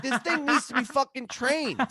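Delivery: high-pitched retold outburst; re-enacted indignant complaint; acted exasperation. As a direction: protest loudly to your sister, high-voiced. Retold quarrel with sister.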